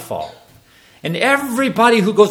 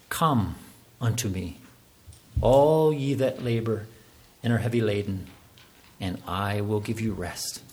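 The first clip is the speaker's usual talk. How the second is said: soft quote; 9 semitones lower, 8 dB quieter than usual. Retold or imitated. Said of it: "maybe softly".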